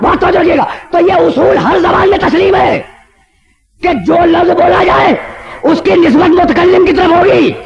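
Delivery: high, ringing preacher's voice pressing the point; impassioned majlis oratory; full-force preaching. Acted. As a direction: drive the argument home to the crowd. Preacher building his argument.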